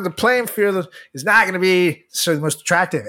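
usual speaking voice

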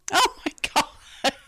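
high, loud stunned gasp; shocked exclamation. Real, not acted. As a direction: react in disbelief. Reacting to rude customer.

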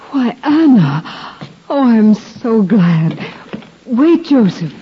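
put-on light up at seeing an old friend; delighted greeting. Greeting her childhood tutor Anna in the temple, delighted.

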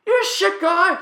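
high, loud Aussie yell; screaming Marcus. Retold or imitated. Teammate berating him after a red shell, screaming. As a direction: hurl it as a screamed accusation. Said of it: "Marcus screaming".

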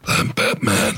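gravelly Batman impression.

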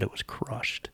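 dramatic hushed whisper. Real, not acted. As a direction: whisper the reveal for effect. Dramatic reveal of damage.

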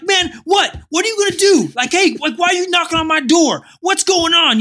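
high, loud re-enacted confrontation; mad screaming. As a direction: act crazy and yell at the intruder. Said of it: "I'm screaming at him, and I'm mad".

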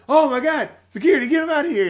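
loud, high cry for security; mock panicked shout. [name] sees Chucky's knife, mock panicked shout. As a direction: yell in hammed-up alarm.

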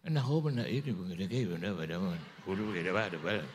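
low, rapid-sounding Batak gibberish; mock Batak speech. Preacher mimics Batak friends talking about him, mock Batak babble. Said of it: "Saya banyak punya teman Batak".

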